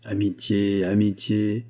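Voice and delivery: wistful, understated sigh over a lost ideal; melancholy. A narrator's reading. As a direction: say it with mild, resigned melancholy. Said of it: "secoua la tête avec mélancolie". (mélancolie contenue)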